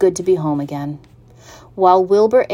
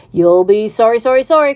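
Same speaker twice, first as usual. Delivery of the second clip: loud warning, climbing in pitch; goose calling out; 4 semitones higher, 8 dB louder than usual. A narrator's reading. Goose warning the pig following slops, rising loud call. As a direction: call a warning, rising higher on each word. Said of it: "called the goose".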